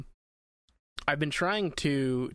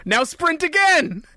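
barked command; 13 semitones higher, 10 dB louder, slower than usual. Retold or imitated. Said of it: "it'll be".

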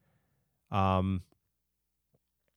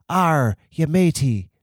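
put-on softer pirate accent; 3 semitones higher than usual. [name] copies the host's pirate line, milder pirate accent. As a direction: try a pirate accent, tentatively. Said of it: "but softer".

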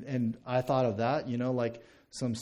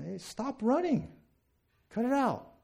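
high-pitched parental command; acted telling-off, voice jumping up; scolding parent; 10 semitones higher than usual. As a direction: call out sharply to running kids. Father scolding his running kids.

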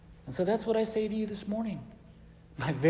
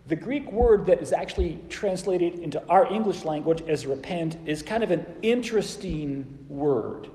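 ordinary delivery

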